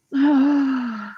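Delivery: long exhaled sigh; heavy sigh. Real, not acted. Upset streamer pauses after venting, long heavy sigh. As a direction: let out one long, weary breath.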